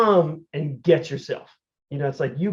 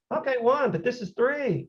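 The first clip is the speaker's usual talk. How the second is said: incredulous counting; 9 semitones higher than usual. Retold or imitated.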